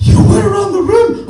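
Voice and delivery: high, loud, incredulous question; imitating a flabbergasted tourist; astonished hiker. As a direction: shout it back in mock amazement, rising at the end.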